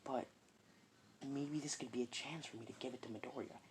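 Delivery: inner thoughts. Acted. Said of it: "process of thinking is like".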